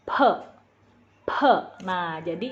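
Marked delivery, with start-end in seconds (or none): 0.1-0.1 "P" as "phe" with a strong puff of air; breath bursting out after the lips open on "P"; aspirated p. Shown as a demonstration. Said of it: "P bacanya keluar angin". 1.3-1.3 "P" as "phe" with a strong puff of air; breath bursting out after the lips open on "P"; aspirated p. Shown as a demonstration. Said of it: "bacanya keluar angin".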